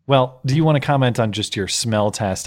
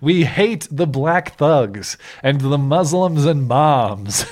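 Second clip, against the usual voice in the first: slow, mock-dumb bigot voice; 4 semitones higher, 4 dB louder, slower than usual. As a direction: parody the letter writer. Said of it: "the paragraph saying".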